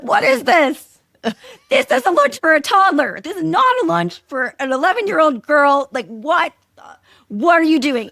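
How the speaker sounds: high, loud retold mom rant; losing it. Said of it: "I lost my fucking mind".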